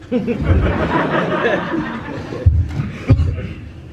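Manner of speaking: airy real laugh; real unvoiced laugh; breathy laugh. Real, not acted. Laughing at own awe.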